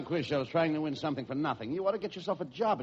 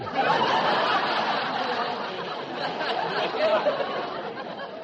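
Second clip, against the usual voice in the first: long breathy laugh; 7 semitones higher, 3 dB louder than usual. Real, not acted. Straight man laughs at the collapsed head joke. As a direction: laugh helplessly at the punchline.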